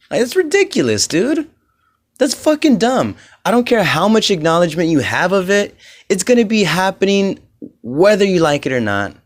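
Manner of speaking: exasperated rant. Real, not acted. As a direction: vent the frustration at full tilt. Ranting about self-righteous people.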